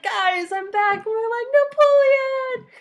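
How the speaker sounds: hammed-up crowd cheer; mock joyous reunion. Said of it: "he's like".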